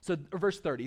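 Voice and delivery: usual speaking voice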